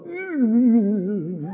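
long high moan sliding downward; imitated drugged moan. Patient's answer to the third question, imitated long moan. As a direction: mimic a sedated patient's wordless whine. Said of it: "your response was".

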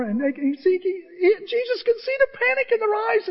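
ordinary talk, nothing marked

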